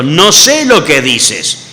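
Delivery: loud, slow denial; emphatic slow refusal; firm denial. Acted. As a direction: Peter brushing off the maid, drawn out. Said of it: "Mas él negó delante de todo".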